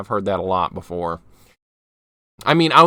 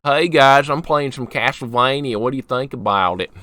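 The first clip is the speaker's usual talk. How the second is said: demonstrating a heavy Southern US accent; thick Southern accent. Shown as a demonstration. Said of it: "A really thick Southern accent would sound kinda like this".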